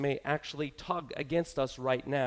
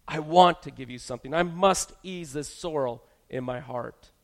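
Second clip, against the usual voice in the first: put-on louder, urgent pleading to the child; forceful, energetic plea; 8 dB louder than usual. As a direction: push force into the voice as you beg. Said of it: "muster up some force in our voice and say with a certain amount of energy".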